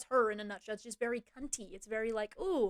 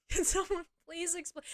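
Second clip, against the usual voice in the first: higher, exasperated appeal to the audience; higher pitched mock-desperate plea; 6 semitones higher than usual. Real, not acted. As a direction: throw the question out, fed up and incredulous.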